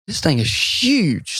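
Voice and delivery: slow, amazed emphasis on HUGE; drawn-out amazement. Retold or imitated. Angler retelling his first look at the fish, amazed.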